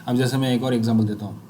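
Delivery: normal talking voice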